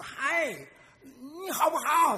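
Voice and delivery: high, slow call across a valley; a child hollering to the echo. Acted. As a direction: call out to the far hillside. Child calls across the mountain.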